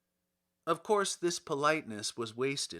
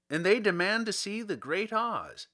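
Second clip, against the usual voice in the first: gatekeeper announcing; 6 semitones higher, 5 dB louder than usual. A narrator's reading. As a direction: proclaim it like a gatekeeper at the door. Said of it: "said the guardian of the gates to him".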